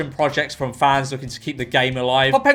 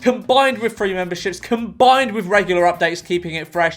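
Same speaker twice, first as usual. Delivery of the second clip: normal talking voice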